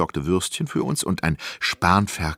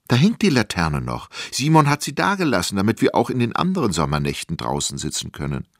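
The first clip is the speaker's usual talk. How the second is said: plain reading voice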